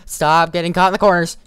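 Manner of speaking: fast, high, loud frustrated yell; frantic yell. Real, not acted. Player stuck in corners while fleeing, frantic yell. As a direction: shout at the stuck character.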